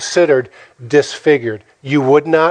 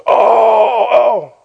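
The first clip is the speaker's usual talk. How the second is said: high, drawn-out "oh"; sustained mock holler, well above normal pitch; imitated movie reaction; 7 semitones higher than usual. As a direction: mimic a long high-pitched cry. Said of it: "And he goes".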